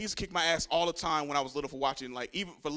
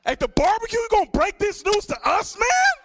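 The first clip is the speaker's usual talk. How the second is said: much higher, much louder mock outrage; family yelling; 10 dB louder than usual. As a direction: shout him down at the barbecue. Family confronting him at barbecue.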